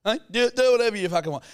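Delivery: mock huff, high and loud; salty dad. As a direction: imitate a dad sulking loudly. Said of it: "he gets really salty with me".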